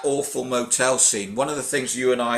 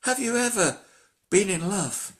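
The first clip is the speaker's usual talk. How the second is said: a mock higher, breathy-free girlish question; imitating Bridget; 8 semitones higher, 4 dB quieter than usual. Podcaster mimicking Bridget asking Stallone, mock higher voice. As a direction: lightly play the starry-eyed heroine asking. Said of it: "Bridget looks at him and she goes".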